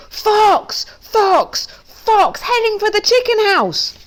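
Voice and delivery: loud, very high shouted warning; crying out in alarm. A narrator's reading. Duck warning of a fox, crying out. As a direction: yell the alarm at the top of the voice. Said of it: "I hear his Duck cry out".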